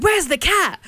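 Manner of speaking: high, urgent mum impression; frantic mum. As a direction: act out a mum demanding help.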